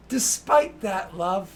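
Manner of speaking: high, slow, loud forced sweetness; strained politeness. Acted. Woman insists firmly to Bastian despite his excuse. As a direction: smile through clenched patience. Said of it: "And she's like".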